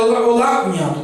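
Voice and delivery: imitating a tearful, strained greeting; a touch of a voice breaking on the phone; mild retold tearful voice; choked-up voice. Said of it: "com a voz muito embargada".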